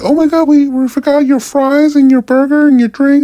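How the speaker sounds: high falsetto mock apology; gushing company rep. Restaurant apologizing over a missing order, high mock fluster. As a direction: squeal it as an overdone imitation of staff. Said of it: "if they wanna be like".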